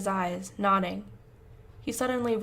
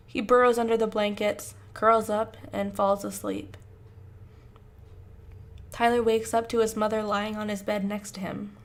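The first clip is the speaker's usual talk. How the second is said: plain reading voice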